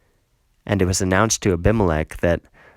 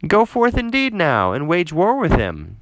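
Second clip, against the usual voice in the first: much higher, scornful challenge; taunting dare; 9 semitones higher than usual. Acted. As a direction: goad him into battle with scorn. Zebul taunts Gaal to fight.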